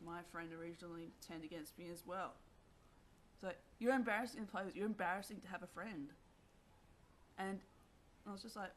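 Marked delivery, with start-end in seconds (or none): 3.8-6.1 contemptuous jeer. Retold or imitated. Former friend turns on her.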